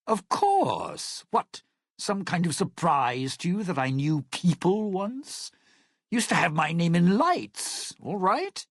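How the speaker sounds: offended has-been star defending his name; indignant. Acted. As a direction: bristle as if your fame was doubted. Bristles at doubt of his fame.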